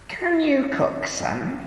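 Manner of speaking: put-on high, curious old woman question. A narrator's reading. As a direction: ask with sudden curiosity. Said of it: "Trudy asked me curiously".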